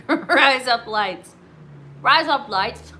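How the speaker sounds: "Rise up lights" said with punch to mimic "razor blades"; trying "razor blades" sound-alike. Shown as a demonstration. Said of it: "razor blades in Australia".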